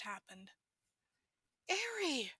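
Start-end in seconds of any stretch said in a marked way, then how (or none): 1.7-2.3 calling out. Acted. Mother calling her lost child.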